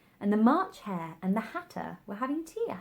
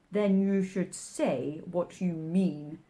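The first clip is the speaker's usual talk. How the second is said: March Hare voice; slower than usual. A narrator's reading.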